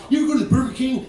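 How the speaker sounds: Dane Cook impression. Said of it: "like Dane Cook".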